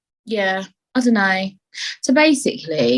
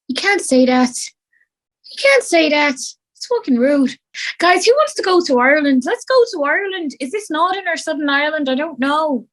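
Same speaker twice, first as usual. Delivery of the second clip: put-on Irish accent; 8 semitones higher than usual. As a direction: exclaim in a hammed-up Irish brogue. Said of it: "It's very addictive".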